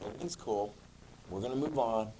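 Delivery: soft, low, laid-back quote; hushed, easygoing let-it-go tone; casual reassurance. Acted. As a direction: drop the voice and shrug it off. Voices friends after resolved conflict.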